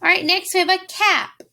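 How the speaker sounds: usual speaking voice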